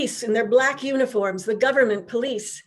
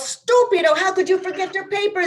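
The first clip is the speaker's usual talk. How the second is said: loud, much higher, exasperated Italian husband yelling; angry shout; 8 semitones higher, 4 dB louder than usual. Acted. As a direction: bellow at her like a fed-up husband. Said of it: "he began to shout".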